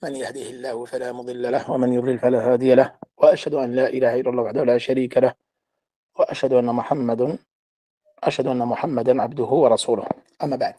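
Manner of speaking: rapid, low, even Arabic recitation; recited opening invocation. Real, not acted. Teacher opening his class with praise of God, rapid recitation. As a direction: run through the opening formula quickly on a level tone.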